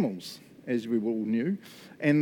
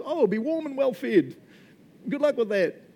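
high, airy brush-off; mock cheery well-wishing; 10 semitones higher than usual. Preacher acting out empty blessing to the needy, mock cheery. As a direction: send them off with hammed-up false cheer.

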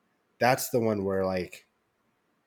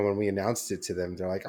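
ordinary delivery

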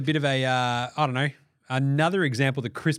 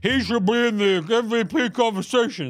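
higher, louder put-on pundit voice; mocking Kendrick Perkins, pitched up; 6 semitones higher, 4 dB louder than usual. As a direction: sound smug and sure of yourself. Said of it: "He's like".

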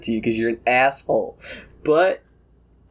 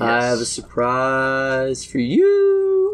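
slow, drawn-out singsong announcement; teasing singsong; much slower than usual. Acted. Character presents a surprise to Bob, teasing singsong. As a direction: stretch it out to tease the surprise.

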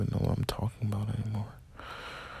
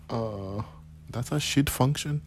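put-on Indian accent; 3 semitones higher than usual. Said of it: "Imagine talking to yourself like that".

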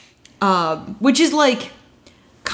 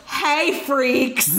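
retold yell; 5 semitones higher than usual. Podcaster recapping a wasted girl's greeting, playful mock yell. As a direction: imitate a drunken holler without full volume. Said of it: "she just yells".

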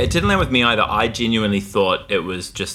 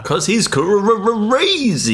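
mock emphatic; 11 semitones higher, much slower than usual. Jokey emphatic answer.

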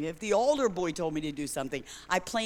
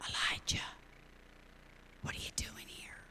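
hushed unvoiced whisper; soft whisper; 11 dB quieter than usual. God whispering to Elijah in the cave, hushed. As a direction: breathe it like God's still small voice. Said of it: "came in a soft whisper".

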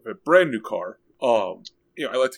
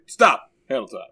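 announcer with feeling; 5 dB louder than usual. Shown as a demonstration. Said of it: "I need it, like, with a, feeling".

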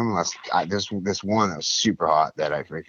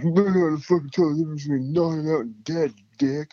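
higher, sneering junkie voice; scornful junkie; 8 semitones higher than usual. Retold or imitated. Junkie mocking his rescuer, higher and sneering. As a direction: sneer it down at a know-nothing.

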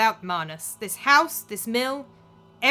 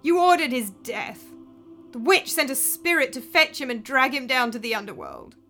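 accusing; 3 semitones higher, 6 dB louder than usual. Acted. Daughter accuses stepmother before crowd.

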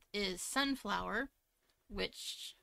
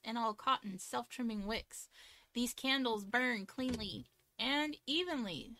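ad read; 4 dB louder than usual. Acted. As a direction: read the sponsor spot evenly. Reads sponsor ad.

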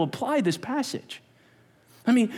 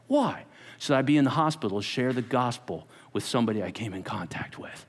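normal talking voice

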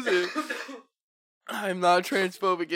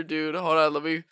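usual speaking voice

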